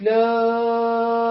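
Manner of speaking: لام held very long in recitation; long madd. Shown as a demonstration. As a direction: stretch لام out as far as it goes. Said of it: "وأقول ألف".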